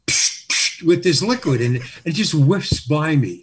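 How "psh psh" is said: unvoiced psh of a perfume bulb; two short hissed spray puffs; spray noise. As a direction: puff it out like a squeeze bottle. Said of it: "he just goes".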